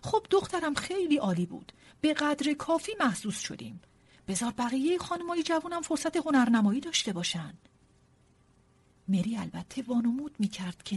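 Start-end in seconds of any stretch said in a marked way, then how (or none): 0.0-7.5 higher-pitched, slightly raised voice. A narrator's reading. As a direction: speak up so the whole room hears. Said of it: "با صدای بلند گفت".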